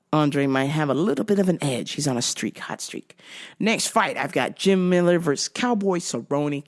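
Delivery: ordinary talk, nothing marked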